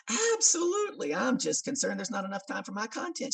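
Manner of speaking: high-pitched, stretched-out assurance; acted keen reply to the boss; over-eager agreement. Eager answer to pushy organizer.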